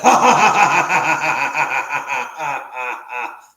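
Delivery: long, loud rattling laugh; loud laughter. Real, not acted. Joking about a broken promise, long loud laughter. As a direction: laugh hard at your own joke, tailing off.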